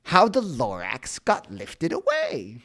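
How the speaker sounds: usual speaking voice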